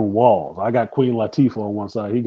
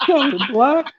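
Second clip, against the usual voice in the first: mock falsetto reply; 12 semitones higher, 4 dB louder, much slower than usual. Mocking friend's line, laughing.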